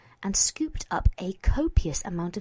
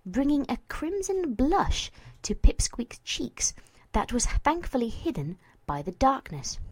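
plain reading voice

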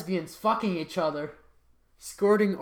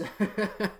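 stifled laugh; 2 semitones higher, 5 dB quieter than usual. Real, not acted. Stifled laugh reading titles.

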